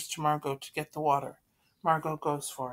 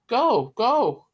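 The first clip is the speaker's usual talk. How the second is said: loud and higher, snapping at Peter; angry shout; 6 semitones higher, 9 dB louder than usual. Acted. Mister Van Dam shouts at Peter to go, angry. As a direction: throw it at the boy, furious.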